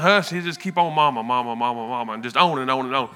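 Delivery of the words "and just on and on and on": ordinary talk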